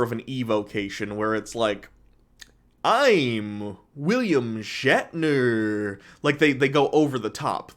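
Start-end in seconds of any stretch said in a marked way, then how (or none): 2.8-6.0 slow, halting mock Shatner with long pauses; William Shatner impression. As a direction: ham up Shatner's broken cadence, stretching every word. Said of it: "pause a lot for William Shatner and kind of talk like this".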